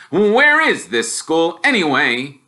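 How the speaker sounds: mock the boy's wizard school; scornful Uncle Vernon jeering; sneering uncle. Uncle demands an answer.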